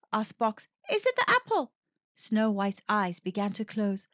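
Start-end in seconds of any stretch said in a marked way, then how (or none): 0.9-1.7 high worried little robot; anxious high question. Acted. Box asks, worried.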